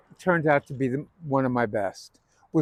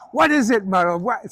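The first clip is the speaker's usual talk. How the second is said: much higher, pushy comic demand; Zero Mostel badgering; 7 semitones higher, 5 dB louder than usual. Tevye sitting on Muddle, high pushy comic demand. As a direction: hammer the question, high and pushy. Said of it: "and he starts".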